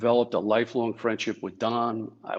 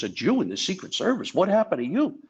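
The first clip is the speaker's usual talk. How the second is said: Rickles ribbing; 9 semitones higher, 3 dB louder than usual. Retold or imitated. Rickles quoted mocking.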